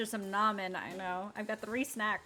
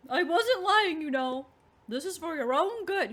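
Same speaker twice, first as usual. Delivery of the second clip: put-on old man voice; 8 semitones higher, 6 dB louder than usual. Tolliver through the door.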